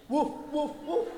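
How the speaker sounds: mimicked barking for food; dog barking. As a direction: woof like a hungry dog. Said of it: "He bark at the ball".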